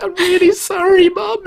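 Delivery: very high mock-crying plea; squeaky, whiny retold sorry; tearful apology. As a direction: imitate a weepy kid apologising to mum. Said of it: "crying 'cause I was a kid".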